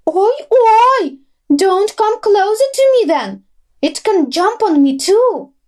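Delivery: high, loud frightened squirrel; screaming squirrel. A narrator's reading. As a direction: shriek to keep the cow away. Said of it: "screamed the squirrel".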